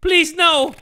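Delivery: high, loud acted cry; hammed-up shout of fear; terrified plea. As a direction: beg for your life. Mia begs before ritual.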